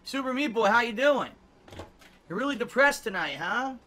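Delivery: high, loud taunting sing-song; mocking. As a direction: taunt him like a playground bully.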